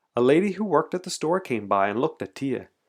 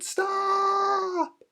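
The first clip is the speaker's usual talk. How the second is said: put-on very high, drawn-out child's cry; long, high mock yell. A narrator's reading. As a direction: shriek it high without full volume. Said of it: "yelled as loudly as she could".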